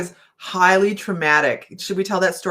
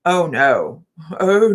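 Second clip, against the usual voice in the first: ordinary talk, nothing marked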